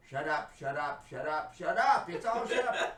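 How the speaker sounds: hammed-up outburst that grows to a shout; exasperated mock yelling.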